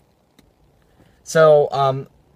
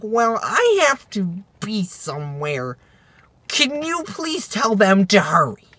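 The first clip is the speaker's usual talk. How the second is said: slow mocking teen voice; imitated entitled pleading, drawn out; put-out teen girls; 3 semitones higher, 4 dB quieter, slower than usual. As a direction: drag it out like a self-important teenager. Mimics put-out teen customers.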